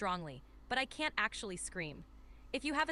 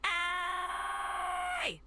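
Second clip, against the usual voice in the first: very long, high held vowel; drawn-out high cry; extended; 8 semitones higher than usual. Shown as a demonstration. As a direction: extend it on one high, steady note. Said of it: "Let's extend that".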